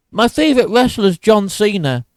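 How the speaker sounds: high-pitched mocking fan voice; mock casual fan. YouTuber parodying a casual wrestling fan, mock high voice. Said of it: "That's like being like".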